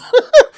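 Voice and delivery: short loud laugh; burst of laughter. Real, not acted. Laughs at his own joke.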